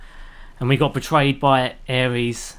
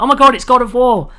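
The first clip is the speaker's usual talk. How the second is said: higher, louder, thrilled gamer; excited; 9 semitones higher, 4 dB louder than usual. Retold or imitated. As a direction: gasp it out like a shocked fan. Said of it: "You're like".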